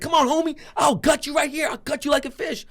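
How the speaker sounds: high, shouted knife threat; threatening. Retold or imitated. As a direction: imitate a worked-up man daring someone to fight. Retold knife threat in a fight.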